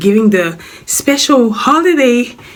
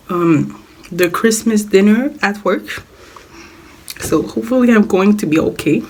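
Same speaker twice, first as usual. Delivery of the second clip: ordinary talk, nothing marked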